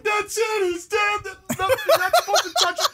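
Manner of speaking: very high, wailing child impression; mock-hysterical pronouncement of doom; distraught kids. Kids seeing dad take the elf down, mock distraught. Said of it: "They're like".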